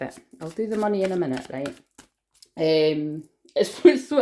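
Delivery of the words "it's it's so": laughing as she talks; words breaking into laughter. Real, not acted. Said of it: "I'm just laughing 'cause".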